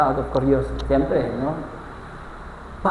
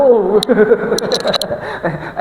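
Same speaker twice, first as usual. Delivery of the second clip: laughter; 5 semitones higher than usual. Real, not acted. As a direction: crack up at your own joke. Laughs at own joke. (malakas na halakhak)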